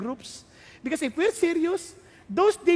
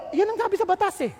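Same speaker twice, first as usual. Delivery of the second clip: usual speaking voice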